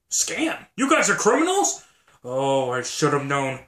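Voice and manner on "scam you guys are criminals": shocked. Acted. Learning they run a scam.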